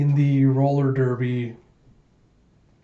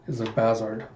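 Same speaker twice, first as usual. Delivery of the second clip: plain reading voice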